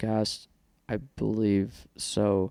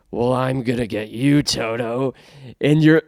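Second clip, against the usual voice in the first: witch line; 2 semitones higher, 6 dB louder than usual. Pretend. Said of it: "Maybe I take a headphone off".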